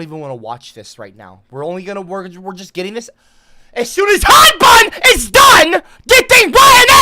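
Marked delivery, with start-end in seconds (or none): 3.7-6.9 high, loud scolding; exasperated shouting. Real, not acted. Streamer scolding a spamming chat, exasperated shout. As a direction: yell it at someone holding everything up.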